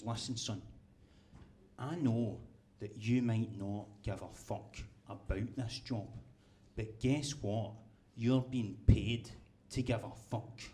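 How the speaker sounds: slow, menacing boss leaning in close; a hint of a hard boss, spelling it out slowly; stern boss. Boss up close to the worker, slow and menacing. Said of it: "the boss ... he goes".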